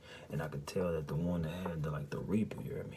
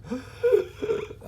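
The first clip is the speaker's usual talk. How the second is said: sharp, high, loud gasp for air; waking terrified from a nightmare, gasping; 10 dB louder than usual. Retold or imitated. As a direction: jolt awake gasping.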